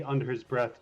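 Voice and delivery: usual speaking voice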